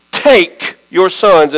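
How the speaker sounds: "take" punched alone, then a pause; stress on TAKE. Shown as a demonstration. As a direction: hit "take" hard and let it hang. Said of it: "I'm gonna emphasize the word".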